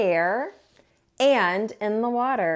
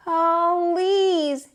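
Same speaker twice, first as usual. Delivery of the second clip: higher, slow pleading; begging; 6 semitones higher, 3 dB louder, much slower than usual. Acted. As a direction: companions begging him. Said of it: "begged his companions".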